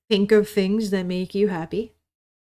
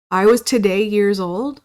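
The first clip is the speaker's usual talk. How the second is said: mocking quote; 8 dB louder than usual. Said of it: "my generation that fucking uses this phrase".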